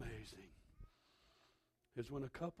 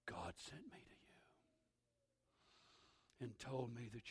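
soft, low, near-whispered words; hushed message; 6 semitones lower, 12 dB quieter than usual. Retold or imitated. Ananias speaks softly to Saul, near whisper. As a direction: hush it like a secret from God.

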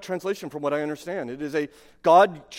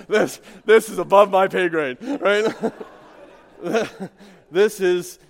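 laughing through the words, louder; 2 semitones higher, 7 dB louder than usual. Real, not acted. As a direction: chuckle it out at his own helplessness. Laughs at his own story.

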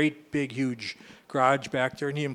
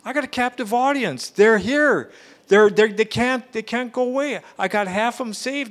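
excited; 9 semitones higher, 7 dB louder than usual. Retold or imitated. Friend gushing about jail.

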